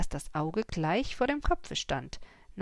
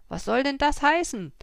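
challenging; 7 semitones higher, 8 dB louder than usual. A narrator's reading. Confronting the horse thief.

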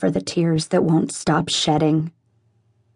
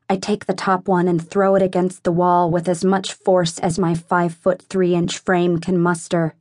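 usual speaking voice

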